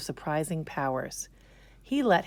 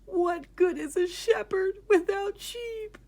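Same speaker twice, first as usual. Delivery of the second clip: much higher, slow, crying plea; high, tearful wail; crying shepherd; 14 semitones higher, 4 dB louder than usual. Acted. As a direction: sob out the question. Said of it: "And he began to cry".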